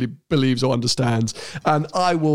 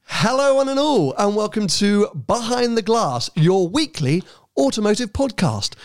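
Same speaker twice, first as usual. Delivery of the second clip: bright, higher announcer intro; podcast intro voice; 5 semitones higher than usual. Shown as a demonstration. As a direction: open the show like a radio host. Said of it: "I want to try a new intro".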